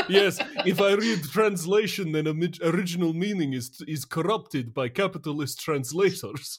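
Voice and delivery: put-on Soviet accent, stiff and earnest; mock Russian accent. Jokes as a Soviet purist.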